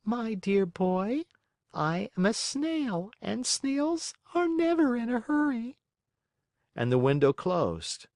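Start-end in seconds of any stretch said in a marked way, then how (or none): 0.0-5.8 calm, peaceful. Acted. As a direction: soothe him without any rush. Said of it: "in a calm, peaceful voice".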